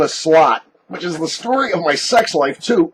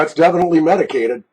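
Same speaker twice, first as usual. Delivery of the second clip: ordinary talk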